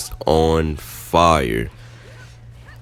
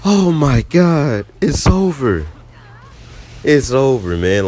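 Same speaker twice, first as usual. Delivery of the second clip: relieved happy outburst; 7 semitones higher than usual. Retold or imitated. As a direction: burst out like the pain just ended. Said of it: "I was so happy".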